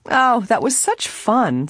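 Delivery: slow, high-pitched delight. A narrator's reading. Nancy sits down after dancing the minuet, delighted. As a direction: draw it out with delight.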